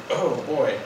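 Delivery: ordinary talk, nothing marked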